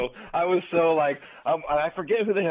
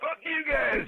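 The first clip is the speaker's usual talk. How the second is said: very high-pitched mock outburst; freaked-out retort; slower than usual. Driver cursing friends laughing at him, retold freak-out. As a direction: squeal it at the others, re-enacting exasperation. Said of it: "being so freaked out".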